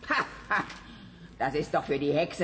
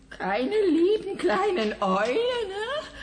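mock crying; 14 semitones higher, 4 dB louder than usual. Manja suddenly pities the owls.

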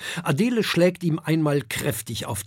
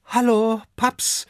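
put-on young dragon greeting; 8 semitones higher, 3 dB louder than usual. A narrator's reading.

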